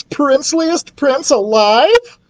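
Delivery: high, slow awestruck boast; gushing queen. Acted. Queen brags of Gilbert to son, gushing. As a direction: stretch it out in high delight.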